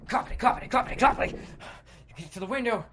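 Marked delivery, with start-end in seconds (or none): 0.1-1.3 high, loud, rattled-off clopping; running footsteps noise. Acted. Player running for the window, pounding footstep sounds. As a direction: chant the footfalls urgently.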